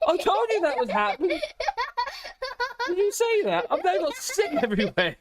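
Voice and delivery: words squeezed out through high laughter; laughing helplessly while talking. Real, not acted. Parent laughing at the baby being sick, laughing. Laughing at child's sudden vomit.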